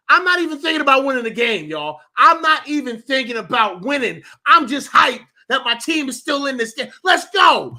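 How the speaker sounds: high-pitched, fired-up fan rant; rally the team with rising excitement; hyped rant. Real, not acted. Fan urging his team to keep fighting, hyped rant.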